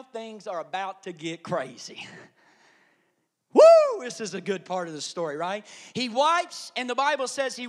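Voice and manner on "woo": high, loud "woo"; excited whoop. Real, not acted. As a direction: whoop with delight at what is coming. Excited whoop before good part.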